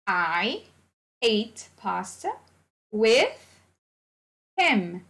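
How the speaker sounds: slow and deliberate, word by word; slow teacher model. Shown as a demonstration. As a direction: spell it out slowly for students. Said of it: "teacher modelling sentence".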